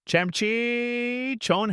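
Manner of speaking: long, high-pitched royal bellow into the night; hammed-up K-drama herald's cry; best K-drama voice. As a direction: draw out each word in a soaring dramatic call. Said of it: "in my best K-drama voice".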